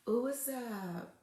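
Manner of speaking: soft, slow, flirty ooh; coy flirty reply. Retold or imitated. Woman answering a flirting man, coy and hushed. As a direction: melt a little as you answer him. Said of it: "You like".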